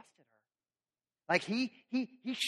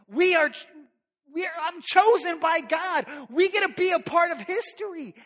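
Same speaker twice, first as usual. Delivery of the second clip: high, loud, thrilled; 10 semitones higher, 7 dB louder than usual. Acted. Mary thrilled to be chosen by God, high and loud. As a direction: gush about being chosen.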